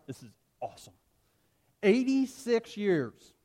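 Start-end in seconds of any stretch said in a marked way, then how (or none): 1.8-3.3 slow, louder and higher, weighing each word; firm declaration. Acted. Old pastor Polycarp answering the proconsul, slow and emphatic. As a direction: lay down eighty-six years like a verdict. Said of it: "Polycarp declared".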